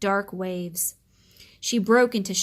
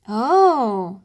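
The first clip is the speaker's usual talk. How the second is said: a louder gasped oh; surprised gasp; 7 dB louder than usual. Acted. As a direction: gasp at the spinning silver whirls.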